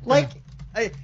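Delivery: ordinary talk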